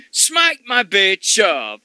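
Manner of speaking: high, slow drawn-out heckle; mock shout. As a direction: yell it at the ring, stretched out. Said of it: "And I'm going".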